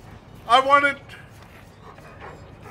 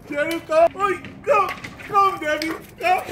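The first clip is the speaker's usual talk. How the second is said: louder, higher call to the dogs; 7 semitones higher, 4 dB louder than usual. Real, not acted. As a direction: call them over firmly. Calling dogs by name.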